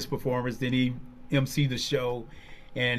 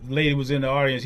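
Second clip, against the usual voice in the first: ordinary talk, nothing marked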